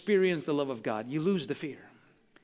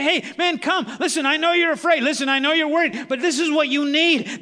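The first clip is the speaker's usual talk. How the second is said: calling out invite; 8 semitones higher, 6 dB louder than usual. Pretend. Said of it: "You say".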